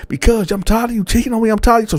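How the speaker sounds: high, fast, furious accusation; imitating an angry girlfriend; accusing girlfriend. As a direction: hurl the cheating accusation. Girlfriend accuses him of cheating.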